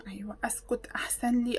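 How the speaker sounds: whispered aside to herself. Real, not acted. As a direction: hush it to yourself. (همس لنفسها)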